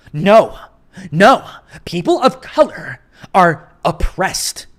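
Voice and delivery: loud, slowed mocking insistence; mock liberal insisting. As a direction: bulldoze him with a preachy correction. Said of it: "It's like".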